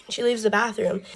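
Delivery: ordinary talk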